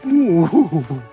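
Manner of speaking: sudden loud ooh; startled ooh. Real, not acted. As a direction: yelp of surprise on slipping. Near slip on icy path.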